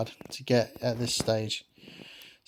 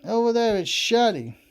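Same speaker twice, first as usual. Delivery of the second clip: put-on alarmed young hero voice; 8 semitones higher than usual. Voicing Yugi, alarmed.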